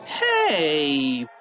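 long, sliding whiny protest; drawn-out childish complaint; whining. A narrator's reading. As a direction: whine it out like an upset kid. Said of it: "whined Freddie".

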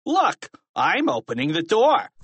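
high, loud mocking show-off; teasing singsong defiance; taunting. A narrator's reading. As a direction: announce it tauntingly, punching the end. Teacher taunts by opening the door.

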